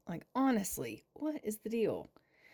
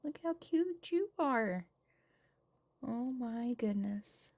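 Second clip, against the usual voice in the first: cooing; 3 semitones higher, slower than usual. Real, not acted. Cooing at cute baby.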